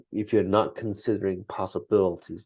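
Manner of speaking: ordinary delivery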